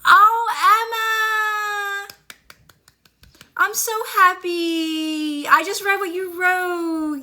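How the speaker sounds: high, slow, teary delight; real joy about to cry; gushing with happy tears, voice up high; overjoyed, teary-eyed. Real, not acted. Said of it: "this is me getting teary-eyed".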